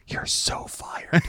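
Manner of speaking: slow, hushed aside to a coworker; whisper. Retold or imitated. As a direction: whisper it slowly across the dock. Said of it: "He goes".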